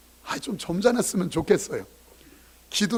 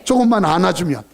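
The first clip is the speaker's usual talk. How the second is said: louder and louder repetition; preaching build; 3 semitones higher, 7 dB louder than usual. Acted. Pastor urging patience, building to a shout. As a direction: build each line more urgent.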